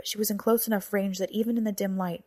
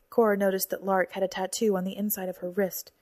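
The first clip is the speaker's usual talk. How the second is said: ordinary delivery, nothing marked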